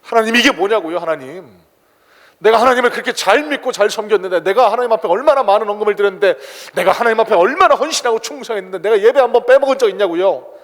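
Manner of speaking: a believer shouting his complaint at God; aggrieved complaint to God. Retold or imitated. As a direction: hurl the grievance at God like a wronged believer. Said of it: "원망 불평입니다".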